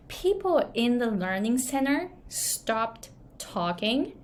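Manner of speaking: normal talking voice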